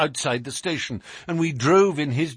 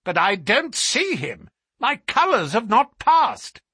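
high anxious cry, climbing louder; great agitation; 10 semitones higher, 4 dB louder than usual. Acted. Colonel cannot see his colours, increasingly agitated. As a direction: protest with rising alarm. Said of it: "cried the Colonel in great agitation".

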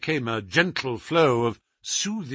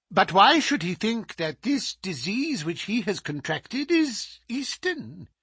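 much higher, suspicious and probing; 6 semitones higher than usual. Acted. The scholar probing the doctor about the disease, high and wary. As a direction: draw out the question, slowing at the end.

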